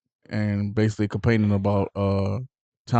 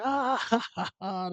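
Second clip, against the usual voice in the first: high mock laugh; imitated laughter; 13 semitones higher, 6 dB quieter than usual. Retelling Harry laughing along at the jokes, imitated high laugh. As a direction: put on a high, drawn-out ha-ha. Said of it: "they all laughing".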